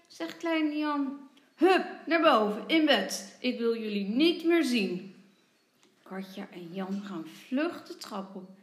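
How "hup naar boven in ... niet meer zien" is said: loud, stern father's command; angry order. A narrator's reading. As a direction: send the children straight to bed. Father orders children to bed.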